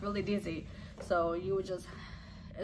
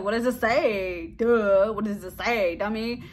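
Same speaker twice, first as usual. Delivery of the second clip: louder, teasing impatience, drawn out at first; mock exasperation; 3 semitones higher, 7 dB louder, slower than usual. Wife pushing husband to read the test, mock exasperation. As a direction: needle him like he's being dense.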